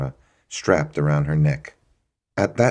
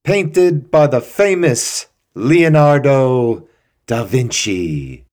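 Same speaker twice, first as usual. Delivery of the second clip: slow, booming, high and loud showmanship; theatrical; 8 semitones higher, 8 dB louder, slower than usual. Acted. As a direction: declaim it like a stage actor. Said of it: "he said theatrically".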